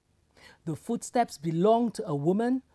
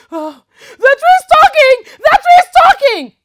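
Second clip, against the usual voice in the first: shrill, much higher panicked scream; terrified yell; 19 dB louder, faster than usual. Acted. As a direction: scream in fright and flee. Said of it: "yelled the milkmaid".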